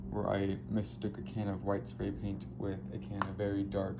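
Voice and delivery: usual speaking voice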